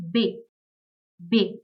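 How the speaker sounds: B said as "beh"; German letter B. Shown as a demonstration. Teacher showing learners the German alphabet, B as "beh". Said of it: "Letter B is pronounced as".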